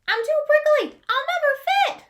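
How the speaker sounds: very high, loud whiny worry; fretting Porcupine. Acted. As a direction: squeal the complaint.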